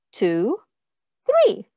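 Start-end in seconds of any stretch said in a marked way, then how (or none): 1.2-1.7 excited reveal. Shown as a demonstration. Counting climax, big high finish.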